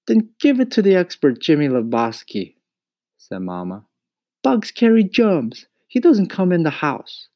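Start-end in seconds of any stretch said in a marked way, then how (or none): 0.1-2.6 high, loud scolding mother; scolding mama. A narrator's reading. Mama tells Mario off over crickets, scolding. 4.4-7.3 high, loud scolding mother; scolding mama. A narrator's reading. Mama tells Mario off over crickets, scolding.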